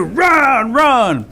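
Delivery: high, loud, urgent shout; shouted warning. A narrator's reading. Rabbit running towards the animals, shouted warning. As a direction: yell it out to scatter everyone.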